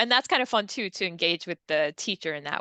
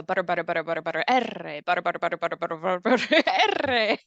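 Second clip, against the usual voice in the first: butter drill into R; much faster than usual. Shown as a demonstration. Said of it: "R is the Spanish R".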